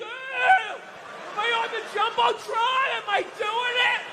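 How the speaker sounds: put-on a drunk fan hoping to be on the Jumbotron; yelling rowdy stadium fan. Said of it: "upper deck with their big beer titties".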